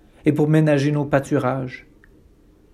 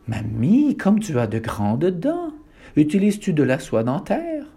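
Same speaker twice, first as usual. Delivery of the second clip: normal talking voice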